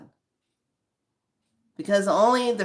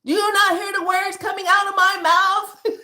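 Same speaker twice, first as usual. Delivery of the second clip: much higher, louder exasperated cry; exasperated speaker; 11 semitones higher, 5 dB louder than usual. Acted. Frustrated person asking why nobody hears her, high and loud. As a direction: throw it up high in disbelief. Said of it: "they still can't hear you".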